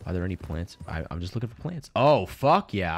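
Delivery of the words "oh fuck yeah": louder, slow drawn-out cheer; real delight; excited find. Real, not acted. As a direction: celebrate spotting the plant. Excited finding plant.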